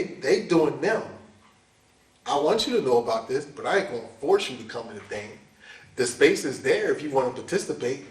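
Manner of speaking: ordinary talk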